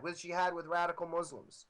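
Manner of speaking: usual speaking voice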